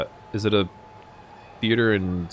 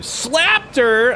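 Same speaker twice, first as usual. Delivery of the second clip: slow, higher, shouted accusation; shouted jab; 14 semitones higher, 5 dB louder than usual. Real, not acted. Streamer shouting at a character over the slap, higher and slow. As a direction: call it out loud and slow.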